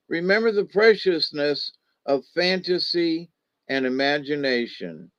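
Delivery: ordinary delivery, nothing marked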